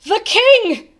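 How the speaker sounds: loud, high, startled gasp of realisation. A narrator's reading. Bartholomew suddenly remembers the king midst the hubbub, gasped. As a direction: cry it out in sudden alarm. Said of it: "Bartholomew gasped".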